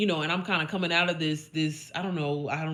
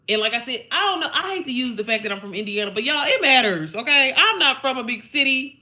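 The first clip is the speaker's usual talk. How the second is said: loud, high, laughing emphasis; loud insistence; 6 semitones higher, 8 dB louder than usual. Real, not acted. Telling viewers about Indiana, loud insistence. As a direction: hammer home that it matters. Insisting her hometown matters.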